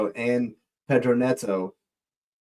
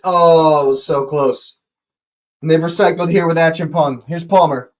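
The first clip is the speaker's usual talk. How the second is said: ordinary talk, nothing marked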